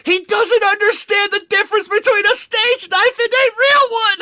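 high-pitched words breaking into laughter; laughing. Real, not acted. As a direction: laugh through the line, squeaking up high. Laughing at the stage knife realization.

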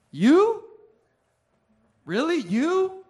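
high, swooping incredulous questions; judging disbelief. Retold or imitated. People judging you by your job, mock incredulous. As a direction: imitate a sceptic, each word leaping upward. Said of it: "They say".

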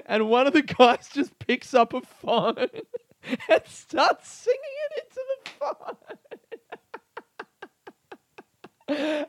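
very high squeal through laughter; helpless real laughter swallowing the words; cracking up. Real, not acted. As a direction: barely get the story out for laughing. Cracking up retelling the video.

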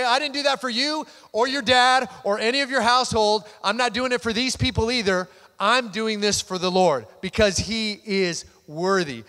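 loud, impassioned rebuttal; David's defiant response. Acted. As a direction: push each phrase out forcefully, slowing to a firm close. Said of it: "and David responds".

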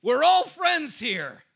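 loud, slow shout at a pig; yelling. Retold or imitated. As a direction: call out loudly and slowly. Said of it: "yelling at the pig".

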